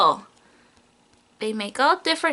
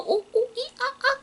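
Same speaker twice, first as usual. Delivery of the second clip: monkey noises; 10 semitones higher, 6 dB quieter, much faster than usual. Pretend. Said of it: "You know what monkeys say?".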